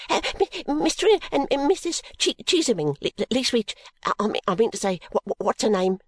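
high, nervous stammering maid; stuttering, flustered servant girl voice. Acted. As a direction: trip over every name in a panic. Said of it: "fearfully mutilates".

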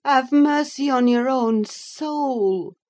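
high, slow, earnest pleading; solemn plea. A narrator's reading. Nurse trying to snatch his glass, pleading slowly and high. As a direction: implore him, drawing out each word. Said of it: "I said".

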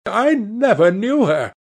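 high, agitated protest; frightened denial. A narrator's reading. Terrified man to his accuser, frightened denial. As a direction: deny it in a fearful rush of pitch. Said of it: "he stammered".

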